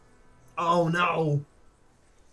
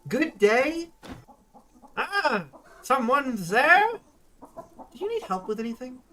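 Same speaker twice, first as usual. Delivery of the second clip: put-on much higher, louder old crone greeting; old woman voice; 11 semitones higher, 7 dB louder, slower than usual. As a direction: play a half-deaf old woman calling out. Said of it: "The old woman".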